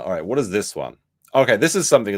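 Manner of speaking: ordinary delivery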